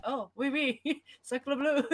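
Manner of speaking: hammed-up French accent, oui oui; mock French accent, laughing through sacre bleu; French chef. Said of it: "And I was like".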